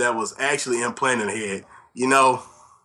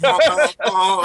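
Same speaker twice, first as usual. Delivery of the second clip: silly clown; 8 semitones higher, 4 dB louder, slower than usual. Pretend. Silly honking banter.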